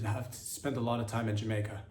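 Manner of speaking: ordinary delivery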